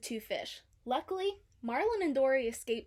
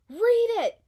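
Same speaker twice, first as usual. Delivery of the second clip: high, very loud urgent command; order; 11 semitones higher, 13 dB louder than usual. A narrator's reading. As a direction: bark it at her in panic. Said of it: "Marlin ordered".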